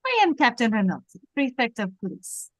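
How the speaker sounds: put-on lightly French-accented police captain. Captain greets a famous visitor at his table, French accent. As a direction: play Renault with a French accent. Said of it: "I think you do the accent".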